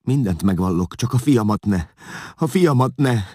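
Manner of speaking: high, loud desperate begging; anguished pleading, building in panic; whimpering plea. A narrator's reading. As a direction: beg for your son's life, pitch climbing. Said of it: "nyöszörgött Vigilász".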